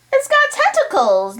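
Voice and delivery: high astonished question; surprised. A narrator's reading. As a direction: squeak out in disbelief. Kid asks about monster.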